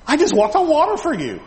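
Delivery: high-pitched mock indignation; indignant protest. Peter objecting to the rebuke, high indignant protest.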